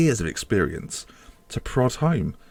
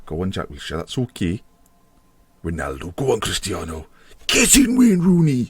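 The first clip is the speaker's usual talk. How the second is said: imitating the co-host. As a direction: cheer each player on, then burst out. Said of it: "but he goes".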